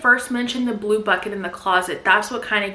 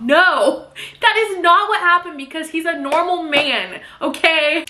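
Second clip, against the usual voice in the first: loud, raised-pitch telling-off; emphatic scolding; 6 semitones higher, 4 dB louder than usual. Real, not acted. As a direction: set her straight, punchy and exasperated. Scolding the character for her fantasy.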